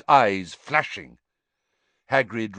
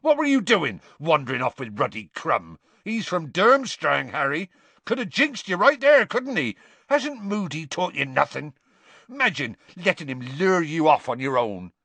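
high, loud angry scolding; 7 semitones higher, 3 dB louder than usual. Acted. Hagrid scolding Harry, loud and angry. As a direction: tell him off hard. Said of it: "said angrily".

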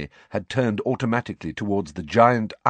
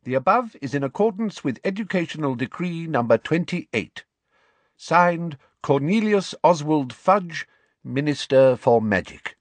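pompous ministry announcement voice; official decree reading; 8 semitones higher than usual. Acted. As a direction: read it like a stiff official notice.

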